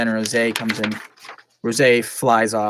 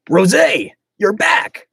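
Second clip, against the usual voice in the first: calling out; 8 semitones higher, 8 dB louder, much slower than usual. Acted. Calls out to Rosé.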